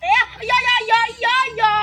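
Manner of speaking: real shouted reaction in the game; urgent shouting. Real, not acted. Urgent reaction during live gameplay.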